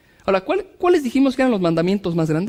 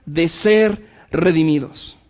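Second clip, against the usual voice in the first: urgent, rising sermon delivery; fervent preaching; 6 semitones higher than usual. Acted. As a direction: press the point home from the pulpit. Preaching at full force.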